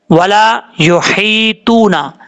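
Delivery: slow वला युहीतूना, with a light, clear ह; slow Quranic recitation; tajweed demo. Shown as a demonstration. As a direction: draw it out and keep the ह thin. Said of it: "भारी हा पड़ जाते हैं".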